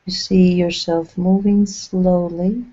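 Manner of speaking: slow-paced meditation voice with long pauses between short phrases; even, measured channeled delivery, leaving lots of silence; guided meditation. Acted. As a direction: guide them gently inward, phrase by phrase.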